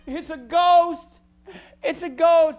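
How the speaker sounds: high, loud, terrified cry; panicked disciples. Acted. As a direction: shriek it like scared fishermen.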